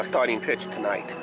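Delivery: normal talking voice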